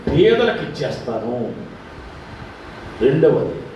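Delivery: a sinner vowing to give back; humble Zacchaeus. Acted. A lightly voiced Zacchaeus pledging to Jesus, slow and humble. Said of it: "అతను అన్నాడు".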